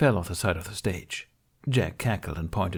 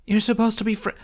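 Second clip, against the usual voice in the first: cut-off protest; 13 semitones higher, faster than usual. A narrator's reading. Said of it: "cut off as they charge".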